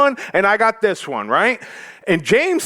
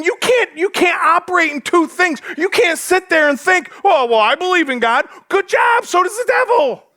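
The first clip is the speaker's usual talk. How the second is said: shaming rebuke; 10 semitones higher than usual. Pretend. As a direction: scold them with biting sarcasm. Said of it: "He's shaming them".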